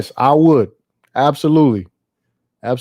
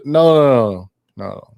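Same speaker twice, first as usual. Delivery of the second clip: ordinary talk, nothing marked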